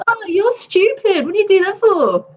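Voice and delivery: high-pitched, exasperated put-down; mock scolding. Shown as a demonstration. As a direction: act out scorn for a stupid move. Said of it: "Or it could be".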